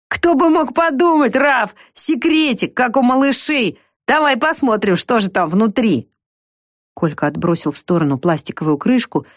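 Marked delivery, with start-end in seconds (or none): 0.1-6.1 put-on high, slow sneering boy; mocking. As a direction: taunt her high and slow. Bully mocks the girl's treasure.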